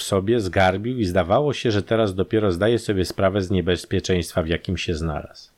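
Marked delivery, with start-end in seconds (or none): none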